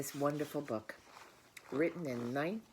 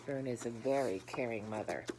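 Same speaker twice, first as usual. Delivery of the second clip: plain reading voice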